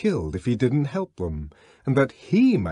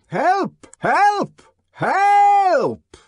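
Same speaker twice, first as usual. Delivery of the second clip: higher and louder with each cry; growing shouts; 6 dB louder, much slower than usual. A narrator's reading. Bernard shouting ever louder to feel useful. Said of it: "more and more loudly".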